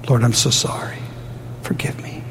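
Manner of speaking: fading to a whisper; contrite prayer. Pretend. Pastor praying for forgiveness, fading into a whisper. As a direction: let forgive me drop to a breath.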